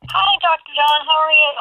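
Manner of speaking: distant speakerphone sound. Real, not acted. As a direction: caller heard through speakerphone. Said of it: "It sounds like you're on speakerphone".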